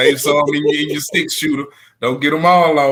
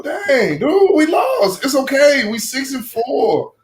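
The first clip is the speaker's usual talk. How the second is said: mock whiny player; 10 semitones higher, slower than usual. Mocking the other team's reaction.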